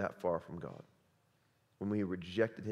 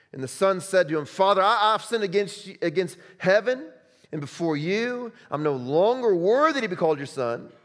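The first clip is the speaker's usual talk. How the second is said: loud, high, fervent scripture reading; loud, high preaching voice; full-force preaching; 8 semitones higher, 11 dB louder than usual. Acted. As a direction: read the father's joy as a loud proclamation. Reads the parable, then exhorts.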